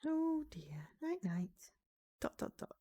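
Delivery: soft breathy hush over a sleeper; whisper. Acted. Vampire whispering over a sleeping guest. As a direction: whisper so she stays asleep.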